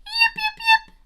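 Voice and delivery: very high, loud little yips; a little fox calling out across the hill toward the music; fox yipping call. Acted. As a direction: call out like a small fox into the night. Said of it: "Little Fox called again".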